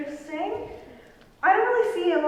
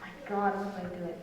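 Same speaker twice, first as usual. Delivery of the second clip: whispered aside; 6 semitones lower, 8 dB quieter than usual. Acted. Counselor despairing aside.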